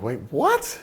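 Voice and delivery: high, baffled retold reaction; acted-out confused double take; WTF disbelief. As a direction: voice jumps up in mock bewilderment. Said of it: "it's a very W T F moment".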